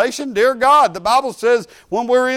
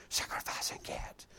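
hushed whispered rumour; a retold whisper; 23 dB quieter than usual. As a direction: breathe it like forbidden gossip.

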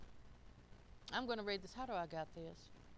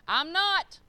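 out loud declaration; 5 semitones higher, 9 dB louder than usual. Shown as a demonstration. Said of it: "I say out loud".

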